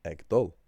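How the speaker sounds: ag dul said clearly and loudly; the common Irish for going; standard dialect form. Shown as a demonstration. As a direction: say ag dul carefully. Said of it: "more common phrase in the other dialects".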